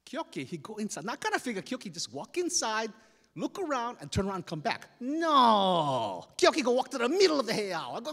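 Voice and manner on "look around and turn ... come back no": plain reading voice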